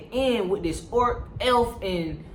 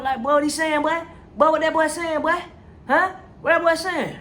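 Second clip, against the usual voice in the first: exasperated demanding; 8 semitones higher than usual. Retold or imitated. Ward pestering his silent partner, high exasperated demand. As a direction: keep hammering what that boy is saying, getting shriller. Ward pressing Nick about the man.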